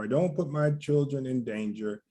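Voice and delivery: normal talking voice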